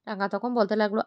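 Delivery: ordinary delivery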